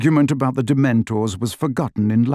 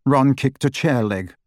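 usual speaking voice

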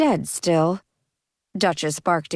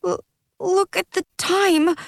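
crying; 11 semitones higher than usual. Acted. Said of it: "Tears streamed down his face".

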